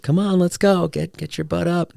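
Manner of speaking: pushy, mock-impatient urging; retold coaxing. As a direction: chivvy a partner out of bed. Rousing partner to hike, retold.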